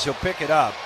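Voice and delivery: ordinary talk, nothing marked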